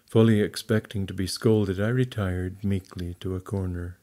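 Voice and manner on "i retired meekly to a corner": ordinary delivery, nothing marked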